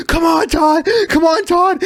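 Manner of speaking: loud encouraging coach yell; coach shouting. Retold or imitated. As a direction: shout it from the sideline. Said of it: "and he'll be like".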